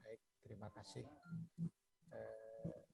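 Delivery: faint, distant voice off mic; speaking too far from the microphone. Real, not acted. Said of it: "boleh suaranya dibesarkan, Pak, atau didekatkan mic-nya?".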